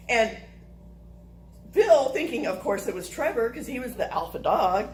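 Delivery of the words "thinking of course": ordinary delivery, nothing marked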